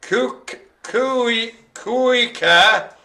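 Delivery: haltingly piecing a foreign name together; sounding it out. Real, not acted. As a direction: stretch out each chunk of an unfamiliar Dutch word. Struggling to read Dutch dog name.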